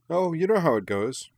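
high, breezy character line; lively reply. A narrator's reading. Minami answering an old friend, lively reply. Minami chatting brightly in narrated story.

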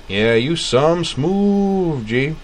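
high, slow amused drawl; Funkatron drawl. A narrator's reading. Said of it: "in a high, amused Funkatron drawl".

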